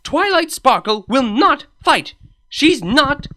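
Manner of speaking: loud, high insistence; angry outburst. Acted. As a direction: cut them off firmly.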